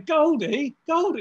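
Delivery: high, loud call across a crowded club; calling "Goldie" after a friend who has wandered off. Retold or imitated. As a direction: shout a friend's name to get his attention.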